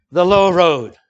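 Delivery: a ringing preacher's punchline; emphatic proclamation. Acted. Sermon point proclaimed.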